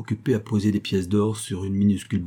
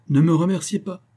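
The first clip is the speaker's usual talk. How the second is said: high, louder, smiling interruption; lightly amused old man, pitched up; subtle smile; 9 semitones higher, 5 dB louder than usual. A narrator's reading. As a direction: cut off the thanks with a sly smile. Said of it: "avec un fin sourire".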